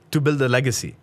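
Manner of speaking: put-on a boy finally snapping back at the dinner table; aggressive outburst. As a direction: blurt it out in frustration. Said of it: "I said it very aggressively".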